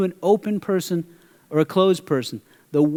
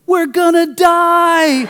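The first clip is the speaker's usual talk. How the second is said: put-on much higher, louder, slow panicked child's yell; kid screaming; 12 semitones higher, 7 dB louder, much slower than usual. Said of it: "I hear a kid in the back go".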